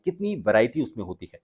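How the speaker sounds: usual speaking voice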